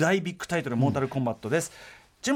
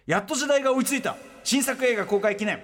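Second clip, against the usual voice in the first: announcer read; 5 semitones higher than usual. Acted. Reads the feature title.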